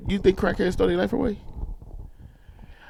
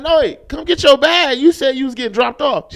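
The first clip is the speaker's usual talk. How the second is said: calling out; 8 semitones higher, 5 dB louder than usual. Retold or imitated. Yelling at auntie to grab bag.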